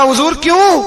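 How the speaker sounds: high retold "why", rising in surprise; startled question. Widow asking the caliph why he stopped, startled. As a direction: ask it taken aback.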